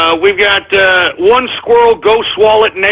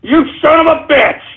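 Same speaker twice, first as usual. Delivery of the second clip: high-pitched indignant curse; furious cursing; 7 semitones higher than usual. Real, not acted. Host cursing a prank name sender, furious outburst.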